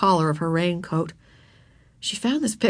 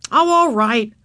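louder, exasperated giving-in; cross boy; 4 semitones higher, 7 dB louder, slower than usual. A narrator's reading. Boy yielding to crying girl, cross. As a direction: agree with obvious annoyance. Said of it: "said Henry crossly".

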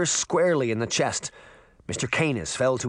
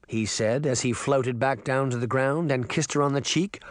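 usual speaking voice

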